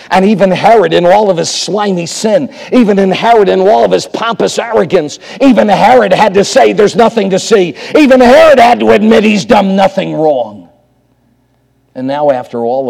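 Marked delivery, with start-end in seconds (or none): 0.1-10.7 raised, insistent preacher's voice; impassioned preaching. Real, not acted. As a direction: hammer each repeat harder, building to a peak. Preacher builds on Herod's verdict.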